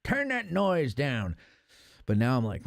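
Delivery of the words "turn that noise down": put-on high, slow crotchety old man voice; grumpy old man. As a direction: play a cranky old man scolding kids about music. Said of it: "I was like an old man".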